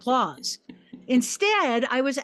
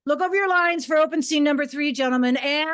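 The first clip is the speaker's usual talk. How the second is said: ordinary delivery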